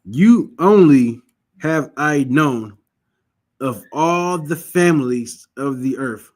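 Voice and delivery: You only have I known, every word drawn out and loud; slow emphatic scripture reading. Shown as a demonstration. Preacher showing the reader how to say the verse, slow and emphatic. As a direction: read it out slowly, leaning on You and all. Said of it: "Say that again".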